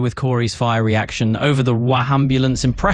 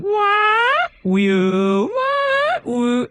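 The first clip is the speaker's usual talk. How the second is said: high swooping wah-wah siren wail; sliding up and down like a crying ambulance; hammed-up siren sob, far higher; much slower than usual. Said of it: "A pro wahambulance goes like this".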